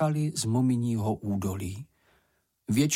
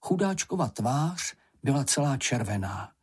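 ordinary delivery, nothing marked

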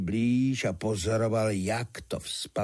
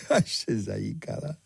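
laughing narration; 5 semitones higher than usual. A narrator's reading. Storyteller echoing the little girl's laughter, laughing. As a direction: chuckle before the words, higher voice. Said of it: "Tereska se tomu smála".

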